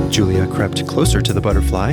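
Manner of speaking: ordinary delivery, nothing marked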